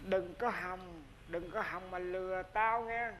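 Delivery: understated menace; quiet warning. Acted. Grandfather warns the tricksters.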